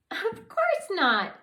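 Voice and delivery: tiny fairy offended by the question; indignant fairy. Acted. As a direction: huff it out indignantly.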